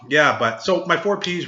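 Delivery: ordinary talk, nothing marked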